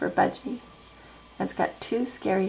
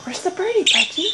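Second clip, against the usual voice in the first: high pet voice; 10 semitones higher, 7 dB louder, faster than usual. Real, not acted. Cooing at the pet bird.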